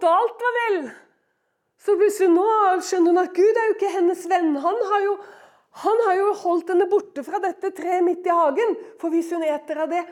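normal talking voice

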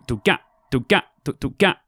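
vocal drum beat, do and gat; beatboxed drum pattern. Shown as a demonstration. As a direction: mimic a drum and bass groove with the mouth. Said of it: "a standard drum and bass beat would be".

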